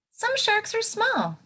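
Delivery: high, light voice on Some sharks are small; tiny voice for small. Shown as a demonstration. Teacher reading to kids, high tiny voice for small. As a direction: go high and little to sound small.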